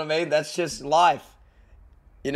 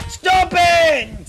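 loud, high, stretched shout; high drawn-out holler; protesting yell; 10 semitones higher, 7 dB louder than usual. Real, not acted. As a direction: yell it in alarm, falling at the end. Yelling at player in game.

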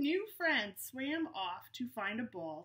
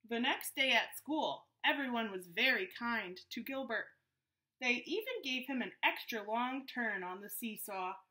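story narration. A narrator's reading. Reads the story aloud.

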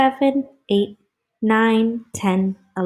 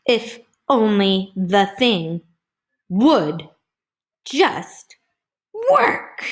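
exasperated outburst; 4 dB louder, slower than usual. Acted. Angry maker whose thing won't work, exasperated outburst. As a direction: punch each word apart in mounting frustration. Heroine's exclaimed frustration, read aloud.

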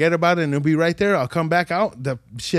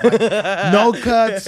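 laughing; 4 semitones higher, 4 dB louder, much slower than usual. Real, not acted. Laughing at unhurt dog.

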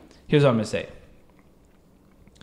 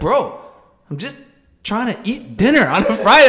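higher, louder, fed-up plea; exasperated; 7 semitones higher, 4 dB louder than usual. Retold or imitated. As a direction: protest like it's no big deal.

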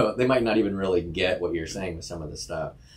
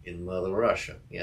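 hammed-up Russian accent. Cohost joking about a Russian childhood, in a hammed-up Russian accent. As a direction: play up the Russian cliché. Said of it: "you do Russian accent better".

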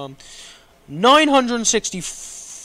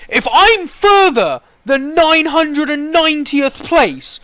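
raised, high-pitched angry warning; furious; 5 semitones higher than usual. Real, not acted. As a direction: rant in real fury at the queue. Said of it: "I'm furious".

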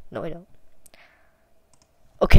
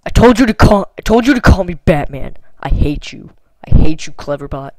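loud, frustrated yelling; loud, fed-up tirade; angry shouting; 14 dB louder than usual. Real, not acted. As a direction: yell at the bot in exasperation. Angry at chatbot Cleverbot.

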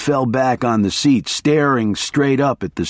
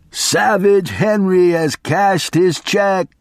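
slow, high, mock menace; snarled; 7 semitones higher, slower than usual. A narrator's reading. Attorney's slow mock snarl at the hitchhiker. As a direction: drag out the threat to scare him.

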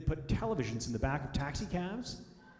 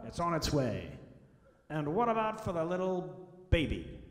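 brash waiter; 3 semitones higher than usual. Retold or imitated. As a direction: play the big booming waiter working the table. Butcher-like waiter taking orders.

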